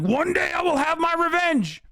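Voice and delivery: high, shouted villain proclamation; anime villain vow. Retold or imitated. Mocking a debater as a shouting anime villain. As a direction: bellow it like a cartoon villain swearing revenge. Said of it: "He's like".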